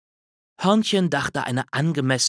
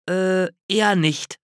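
sheepish admission; 7 semitones higher than usual. A narrator's reading. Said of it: "gestand Hörnchen".

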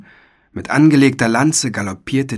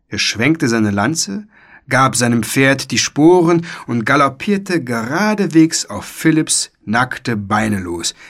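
ordinary delivery

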